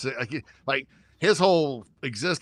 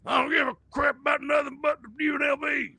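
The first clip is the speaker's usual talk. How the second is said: mock shout; 13 semitones higher than usual. Real, not acted. Shouting support for UNLV.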